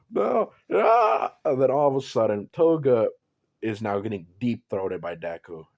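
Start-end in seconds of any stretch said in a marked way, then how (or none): 0.1-1.3 high, hammed-up shriek of distress; high mock cry, louder; mock anguished cry. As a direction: let out a fake high wail. Toga crying out in the scene.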